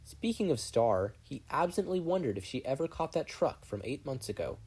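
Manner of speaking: usual speaking voice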